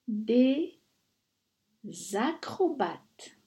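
usual speaking voice

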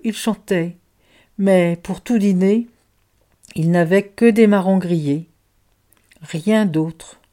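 plain reading voice

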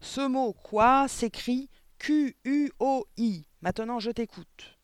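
high, loud, slow spelling; vociferating. A narrator's reading. Headmistress drills the spelling into the boy, vociferating. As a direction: bellow the letters one by one. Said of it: "continuait à vociférer".

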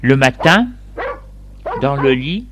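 ordinary delivery

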